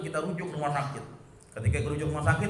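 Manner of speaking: usual speaking voice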